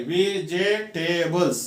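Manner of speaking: "वेजिटेबल्स" in four separate parts; syllable by syllable. Shown as a demonstration. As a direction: break वेजिटेबल्स into वे-जि-टे-बल्स. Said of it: "four parts करके बोल रहे हैं". ("वेजिटेबल्स" चार हिस्सों में तोड़कर)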